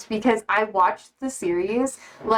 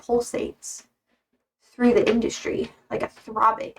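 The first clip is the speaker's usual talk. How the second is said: eerie breathy whisper. Pretend. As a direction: hiss it out like a creepy secret. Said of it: "I don't know why I did that".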